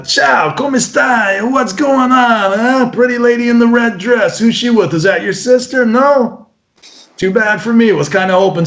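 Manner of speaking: high-pitched Italian flirt; forward, teasing Italian-accented patter; funny, aggressive Italian. Acted. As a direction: play a cheeky Italian magician hitting on a guest. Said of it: "Italians will just be funny, aggressive".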